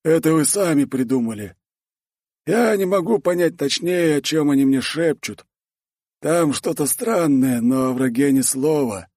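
high dwarf character voice, slowing later; dwarf Torgvald, explaining. Acted. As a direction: explain it pitched well above normal.

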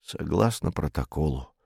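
low, soft, tired reply; weary. A narrator's reading. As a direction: answer wearily, with little energy. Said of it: "устало сказал комендант". (устало, тихо и низко)

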